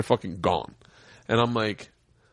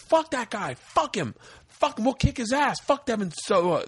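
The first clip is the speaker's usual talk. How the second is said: imitated angry yelling; 10 semitones higher than usual. Imitating friends riled up at bouncers, high mock angry yell. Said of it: "they're fucking yelling at bouncers".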